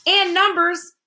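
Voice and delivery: high, emphatic, insistent interjection; forceful addition. A narrator's reading. Math magician correcting the king, forceful. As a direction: push it in forcefully. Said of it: "added the math magician forcefully".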